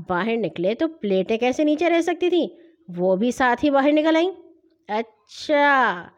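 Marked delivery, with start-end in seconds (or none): 4.9-6.1 long drawn-out, slow word; knowing realization. Acted. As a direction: stretch it out as you catch them red-handed. Brother discovers the hidden plates. (لمبا کھینچ کر، معنی خیز انداز)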